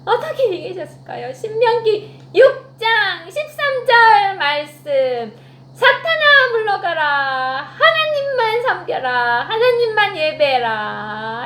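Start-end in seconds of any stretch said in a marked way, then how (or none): 1.3-11.4 slow, loud commanding voice, high on 사탄아; commanding rebuke. Acted. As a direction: banish the devil with authority. 예수님이 사탄을 꾸짖음. (천천히 크게 호령하는 꾸짖음)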